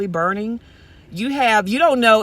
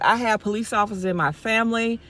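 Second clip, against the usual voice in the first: normal talking voice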